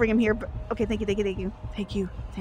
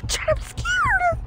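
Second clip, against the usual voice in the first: mock scared; slower than usual. Streamer hiding from monster.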